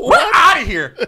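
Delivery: high, loud shouted exit line; mock walkout. Joking about leaving the restaurant, mock-outraged shout. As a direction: holler it with a laugh.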